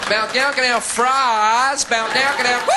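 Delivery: high, loud fake American noises; mock American gibberish. Parisian mocking the American tourist in a loud fake American accent. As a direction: parody American English as babble. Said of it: "an American".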